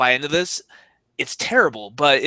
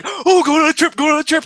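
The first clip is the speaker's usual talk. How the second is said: much higher, eager wife as a dog; mock excited dog; 12 semitones higher, 5 dB louder than usual. Cohost mocks wife treated like a pet dog, eager and high. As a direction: squeal it like a dog excited for a walk. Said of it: "your wife's like".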